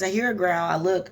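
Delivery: ordinary talk, nothing marked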